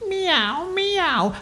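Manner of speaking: very high little cat mews; pretend-scared meowing. A narrator's reading. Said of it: "still pretending to be scared".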